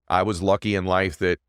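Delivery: ordinary talk, nothing marked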